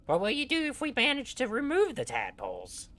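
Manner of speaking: high squeaky gnome; Grupham's gnome voice. Acted. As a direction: pitch way up like a little gnome. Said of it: "this is my gnome voice for Grupham".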